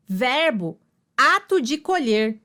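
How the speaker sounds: ordinary talk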